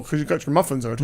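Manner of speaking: talking with a mouthful of nuts; mouth full. Real, not acted. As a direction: chew while you talk. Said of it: "I'm eating with a mouthful of nuts".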